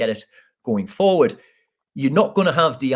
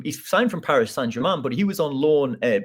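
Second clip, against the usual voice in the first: ordinary talk, nothing marked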